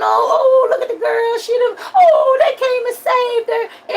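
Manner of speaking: high, wailing mock sob, half laughing; mock sobbing. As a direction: blubber through the happy ending, hammed-up. Acts out crying at movie.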